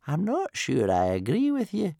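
put-on high, thin old man's wheeze; wheezing old man. A narrator's reading. Old Wrinkly disagreeing with Stoic, wheezing. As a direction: creak it out like an ancient grandfather. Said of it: "wheezed old Wrinkly".

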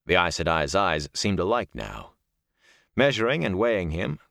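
ordinary delivery, nothing marked

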